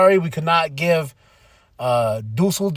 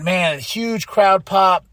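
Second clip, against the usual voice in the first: ordinary delivery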